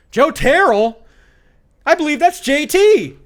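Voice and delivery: high, loud double-take; loud mock amazement. Host spotting dad's name in the chat, loud mock amazement. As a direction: blare it out in hammed-up recognition.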